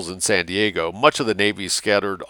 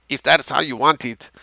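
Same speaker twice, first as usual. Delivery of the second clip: put-on higher voice with Mexican accent; Mexican commander; 6 semitones higher than usual. A narrator's reading. Mexican commander answers a civilian, Mexican-accented reply. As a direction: play a dapper officer with a Mexican accent. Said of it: "the dapper Mexican commander was saying".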